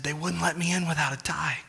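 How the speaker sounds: breathless whisper of an exhausted man; whispered punchline. Acted. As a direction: deliver the punchline in a weak whisper.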